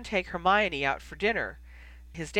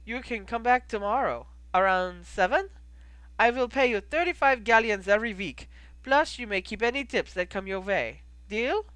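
put-on higher-pitched innkeeper with a foreign accent; older innkeeper, foreign accent, pitched up; 5 semitones higher, 3 dB louder than usual. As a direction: play the innkeeper in an accented, lifted voice. Plays innkeeper Goldie.

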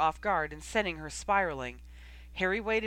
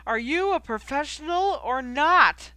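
much higher and louder slow angry lecture; furious shout; 8 semitones higher, 9 dB louder than usual. A narrator's reading. Coach Oliver railing at Knight, slow furious shout. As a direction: rail at her, every word hammered. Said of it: "as he began to rail".

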